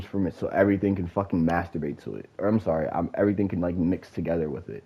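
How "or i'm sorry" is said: ordinary delivery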